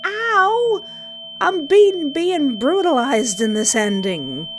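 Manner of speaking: very high, loud yelp of pain that settles; comic pained yelp. Acted. Protagonist slammed against the wall, yelping in comic pain. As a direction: yelp like a cartoon victim.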